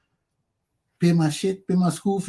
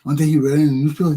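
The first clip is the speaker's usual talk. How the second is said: usual speaking voice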